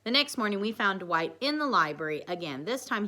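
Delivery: ordinary delivery, nothing marked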